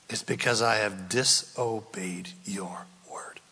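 soft whispered prayer; whispered confession. Acted. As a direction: confess it under your breath.

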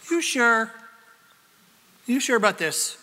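lightly voiced serpent, coaxing doubt; sly serpent. Preacher playing the serpent tempting Eve, mildly sly. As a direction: tempt her with a touch of doubt.

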